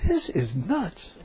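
soft, slow incredulous aside; muted disbelief. Retold or imitated. Preacher retelling what he thought of the voice, slow hushed disbelief. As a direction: draw each word out quietly, as a private thought. Retold inner doubt.

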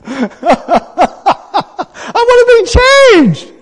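high, loud hammed-up wail; mock cry. Preacher teases people who stay as they are, mock cry. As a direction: wail it out in playful exaggeration.

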